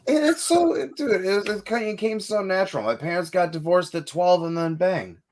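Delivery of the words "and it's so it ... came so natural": high squeaky voice. Real, not acted. Singer boasting scream came naturally.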